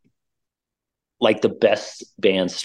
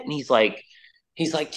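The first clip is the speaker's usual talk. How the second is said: normal talking voice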